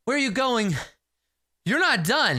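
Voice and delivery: high, insistent challenge; indignant warrior. Acted. Club-holding warrior confronts the hero, high and insistent. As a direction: stop them in their tracks.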